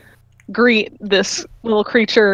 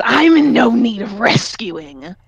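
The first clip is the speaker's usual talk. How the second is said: sassy; slower than usual. Acted. Dragon answers the rescuers with sass. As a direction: toss it off with sass.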